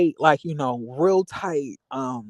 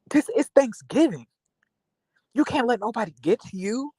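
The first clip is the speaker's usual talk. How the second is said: higher-pitched big-brother scolding, half-mock; imitating a scolding friend; 8 semitones higher than usual. Friend scolds him for fighting on Thanksgiving, higher mock scold.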